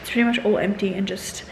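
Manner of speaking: ordinary talk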